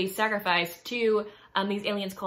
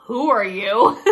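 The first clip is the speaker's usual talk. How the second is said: startled; 2 semitones higher, 13 dB louder, slower than usual. Retold or imitated. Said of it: "she's like".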